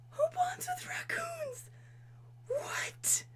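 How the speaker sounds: high whispered disbelief; incredulous squeak. Real, not acted. Reacting to viewers about raccoon bonding, incredulous squeak. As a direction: squeak it out, baffled.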